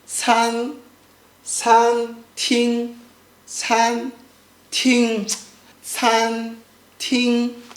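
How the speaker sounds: SAN TING, slow level syllables; sweet first tone. Shown as a demonstration. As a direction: hold san and ting flat on one pitch. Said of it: "is very sweet".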